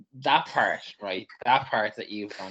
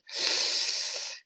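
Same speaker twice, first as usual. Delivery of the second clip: sharp intake of breath through the teeth; wincing hiss of indrawn breath; inhale through teeth; 4 dB quieter than usual. Shown as a demonstration. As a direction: suck air in through your teeth, doubtful. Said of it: "inhaled through my teeth".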